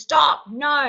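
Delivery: loud, high barked Stop and No; sharp stop. Shown as a demonstration. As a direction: snap Stop at a child. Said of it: "We really shouldn't be saying things such as".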